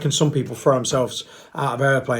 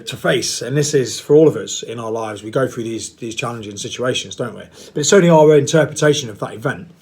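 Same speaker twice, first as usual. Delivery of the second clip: ordinary delivery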